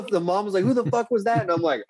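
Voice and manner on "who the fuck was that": higher, mildly outraged mom; mock angry mom. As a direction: demand it like an irate mother.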